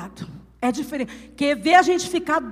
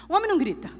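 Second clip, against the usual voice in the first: ordinary delivery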